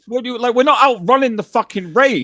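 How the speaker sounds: high, exasperated protest; arguing with his mate, high and exasperated; exasperated disbelief. Retold or imitated. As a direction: push back, voice way up. Arguing against outrunning rain.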